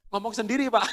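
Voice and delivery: words breaking into laughter; laughing. Real, not acted. Speaker laughing as he retells the teacher's complaint. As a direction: laugh through the punchline.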